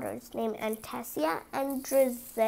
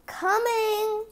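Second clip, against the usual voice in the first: higher, put-on girl voice calling; 9 semitones higher than usual. Cinderella answers her stepmother's shout, higher. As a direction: call back cheerfully. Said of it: "called Cinderella".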